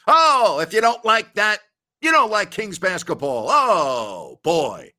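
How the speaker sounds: high, loud sportscaster's call with drawn-out cries; catchphrase shout. Acted. Host hyping the first half of the Kings season, booming catchphrase shout. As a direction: belt it out like a big-play call.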